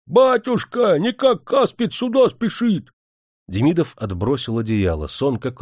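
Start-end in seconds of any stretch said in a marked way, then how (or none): 0.1-2.9 high, loud, slowing servant's yell; bawling. A narrator's reading. Servant waking his master with a warning, bawling. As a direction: bawl it through the whole house. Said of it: "заорал на весь покой".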